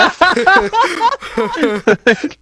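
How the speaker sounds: long high giggling fit; high-pitched laughter. Real, not acted. Player breaks into laughter.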